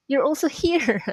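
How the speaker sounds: surprised and laughing; surprised greeting. Acted. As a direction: act bumping into a friend by chance. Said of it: "Something like that".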